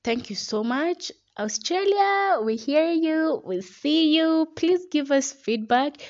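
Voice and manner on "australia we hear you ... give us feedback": high and louder, hailing far-off fans; calling out. Real, not acted. Host calling out to listeners in Australia, loud and high. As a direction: shout out to Australia with a slow, rising call.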